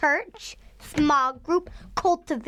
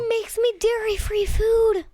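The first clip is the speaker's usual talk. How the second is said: high, eager burst; loud excitement; 8 semitones higher, 3 dB louder than usual. Real, not acted. Child bragging to dad about dinner at church, loud excitement. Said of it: "You're loud".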